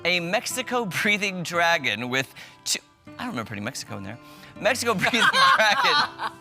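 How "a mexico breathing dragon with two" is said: ordinary delivery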